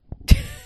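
short high laugh; high burst of laughter. Real, not acted. Reacts to King recipe.

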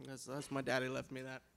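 normal talking voice

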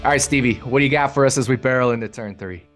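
ordinary talk, nothing marked

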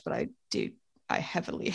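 soft, unvoiced confession; whispered. Real, not acted. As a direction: whisper it like a guilty secret. Admits judging people.

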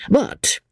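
high, pointed "But"; slow emphasis. A narrator's reading. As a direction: set "But" apart as a warning. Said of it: "with slow emphasis".

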